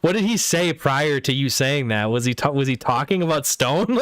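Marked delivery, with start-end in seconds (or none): none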